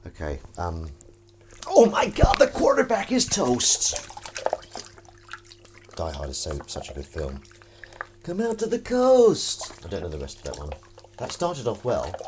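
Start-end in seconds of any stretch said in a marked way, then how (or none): 1.7-4.1 high, loud, slow squeal of a line; high movie quote voice. Retold or imitated. Washing up, he belts a misheard Die Hard line high. 8.2-9.7 high, loud, slow squeal of a line; high movie quote voice. Retold or imitated. Washing up, he belts a misheard Die Hard line high.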